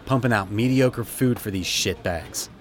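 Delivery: ordinary delivery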